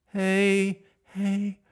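clean sung hey, no air; clear hey. Shown as a demonstration. Coach demonstrating non-breathy tone. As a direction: drop the breath, sing clean. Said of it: "or not breathiness".

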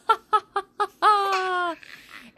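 high, loud gleeful cackle; evil cackle. Acted. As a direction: cackle like a scheming villain. Gloating laugh after all mine.

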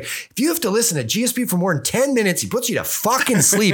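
high-pitched imitation of promo trash talk; mock trash talk. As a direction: act out a fighter needling his rival. Retells hostile fight promo trash talk.

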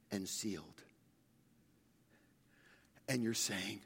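low, soft preaching with pauses between phrases; hushed pastoral appeal. Real, not acted. Pastor addressing the discouraged in church, hushed pastoral appeal. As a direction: speak gently to those who feel trapped.

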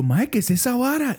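high startled question. Retold or imitated. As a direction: react to a strange creature. Retelling startled reaction.

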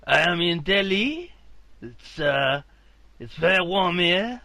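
put-on loud Indian accent drifting into French; botched Indian accent. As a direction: play Indian tech support with a slow, high, badly faked accent. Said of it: "sound like you're in France".

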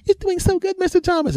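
very high sweet cheering voice; cheering woman. Retold or imitated. Women encouraging runner, very high sweet voice.

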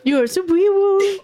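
high, playful cutesy voice; baby talk. Pretend. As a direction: coo it with mushy baby-talk consonants. Cooing at the new superhero.

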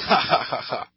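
mocking villain laugh; hammed-up sneering laughter; scornful laugh. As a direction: laugh off the threat. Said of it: "And then Vegeta says".

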